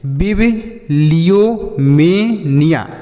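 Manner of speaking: बिबिलियोमेनिया very slowly, "bib, liyo, me, niya"; slow syllable-by-syllable demo. Shown as a demonstration. Teacher showing children how to read it, बिबिलियोमेनिया slow in separate chunks. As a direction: break बिबिलियोमेनिया into drawn-out parts with pauses. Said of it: "ऐसे पढ़ेंगे".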